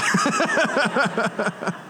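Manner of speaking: high, rapid chuckle at himself; self-mocking chuckle; laughing. Real, not acted. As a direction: burst into a staccato laugh. Laughing at his own found key.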